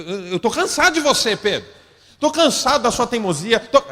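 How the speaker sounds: loud, high, hammed-up nagging rant; exasperated scolding. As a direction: berate a stubborn friend, mock-furious. Jesus imagined scolding stubborn Peter.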